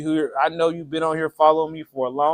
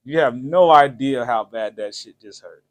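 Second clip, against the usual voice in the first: usual speaking voice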